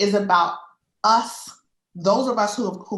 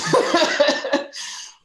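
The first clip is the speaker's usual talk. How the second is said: high, breathy laugh of embarrassment; embarrassed teary laugh; 9 semitones higher than usual. Real, not acted. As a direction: laugh off the tears. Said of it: "I'm sorry for my shaky voice".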